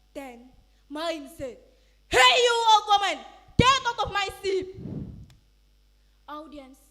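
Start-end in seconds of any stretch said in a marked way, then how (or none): 2.1-4.7 much louder contemptuous order; angry shout. Acted. Son ordering his mother off the ship, angry shout. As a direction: drive her off.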